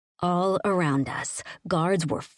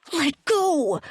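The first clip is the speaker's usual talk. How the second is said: roaring; 10 semitones higher than usual. A narrator's reading. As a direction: roar it at your captor. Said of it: "I roared".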